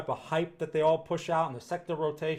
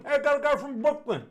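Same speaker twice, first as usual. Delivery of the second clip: put-on higher, louder old-school Brooklyn accent; 10 semitones higher, 5 dB louder, faster than usual. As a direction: ham up a classic Brooklyn guy. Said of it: "They don't talk like that anymore in Brooklyn".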